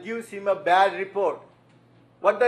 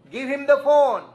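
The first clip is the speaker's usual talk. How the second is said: higher-pitched, decisive instruction; retold firm command; 5 semitones higher, 3 dB louder than usual. Preacher tells caller to hand over the phone, firm command. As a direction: give a confident order.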